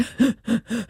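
short, high, quiet gasps for breath; imitated sobbing gasps. Lawyer recalls the sobbing boy, imitated shuddering gasps. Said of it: "he's sobbing and he's like".

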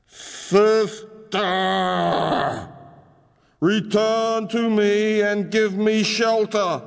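high, slow giant's plea; pleading mountain voice. Acted. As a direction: call out to a sister, begging. Mountain brother calls to sister.

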